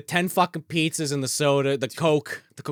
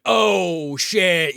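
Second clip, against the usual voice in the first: retold dismay; 3 semitones higher than usual. Recalls own dismayed reaction.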